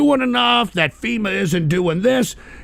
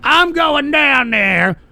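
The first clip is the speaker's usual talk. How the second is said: higher, slow, drawn-out mock pledge; mock virtuous vow; 8 semitones higher, 4 dB louder, much slower than usual. Streamer mocks virtue-signalling volunteers, slow smug parody. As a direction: stretch it out like a smug hero. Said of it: "show that they're fucking virtuous".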